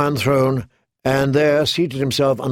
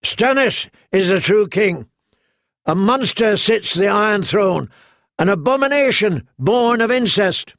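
higher, ringing shout of defiance; 7 semitones higher than usual. A narrator's reading. Knight shouts treason before the court, higher shout. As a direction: proclaim it across the hall. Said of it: "shouted out".

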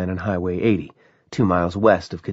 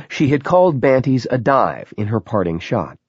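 plain reading voice

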